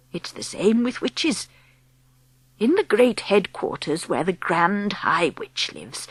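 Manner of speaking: put-on calm whispery old woman explaining; old grandmother.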